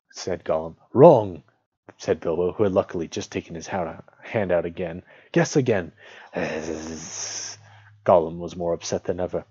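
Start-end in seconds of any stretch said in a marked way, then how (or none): 0.9-1.4 loud, raised call; emphatic rejection. A narrator's reading. Bilbo rejects Gollum's guess. 5.3-5.9 loud, raised prompt; bold challenge. A narrator's reading. Bilbo challenges Gollum to guess. 6.4-7.6 long sibilant creature hiss; drawn-out seething hiss; frustrated hiss. A narrator's reading. Gollum hisses in frustration.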